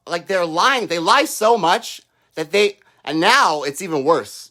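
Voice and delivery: ordinary talk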